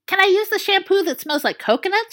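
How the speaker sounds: bright, high hopeful ask. A narrator's reading. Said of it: "she asked hopefully".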